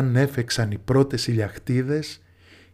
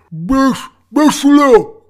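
high, shouted command; insistent order; 12 semitones higher, 9 dB louder, slower than usual. A narrator's reading. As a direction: shout it, losing all patience. Quarrel over getting into the carriage. (επιτακτική φωνή, δυνατά και ψηλά)

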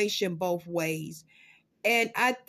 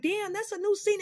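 high impressed; 9 semitones higher than usual. Retold or imitated. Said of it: "I'm like".

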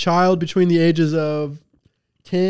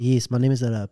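low mock-Italian introduction; Italian accent; 4 semitones lower than usual. Playing along with the beanie joke, Italian accent. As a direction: put on an Italian accent, pitched down. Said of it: "speaking in an Italian accent".

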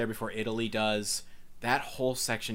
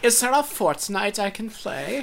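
higher, louder mock German accent, laughing; 11 semitones higher, 6 dB louder than usual. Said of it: "In a German accent".

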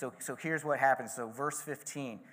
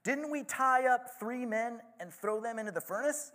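much higher, astonished question; exclaimed in amazement; 10 semitones higher, 3 dB louder than usual. A narrator's reading. King exclaiming to his advisors, astonished. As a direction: gasp it out in disbelief. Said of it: "he exclaimed to his advisors".

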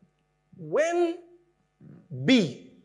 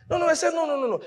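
high-pitched, insistent retold protest; protesting objection; 12 semitones higher, much faster than usual. Student objecting to his professor, retold in high protest. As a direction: jump in high, refusing the answer.

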